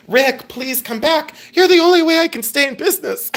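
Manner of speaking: high, mock-desperate begging; Tiny Tim pleading. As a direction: plead in a put-on high little voice. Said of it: "It's Tiny Tim".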